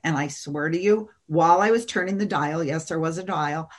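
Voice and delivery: normal talking voice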